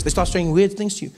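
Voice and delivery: ordinary talk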